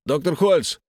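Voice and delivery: loud call for the doctor. Acted. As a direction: call through the locked door. Locked in, calling doctor.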